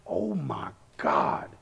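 slow, hushed, drawn-out awe; amazed under the breath; starstruck. Retold or imitated. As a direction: imitate a stunned fan, low and soft. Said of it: "He was so excited".

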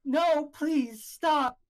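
high, urgent begging; frightened pleading. Acted. Trapped woman begs her captors, high frightened plea. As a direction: plead for it to stop.

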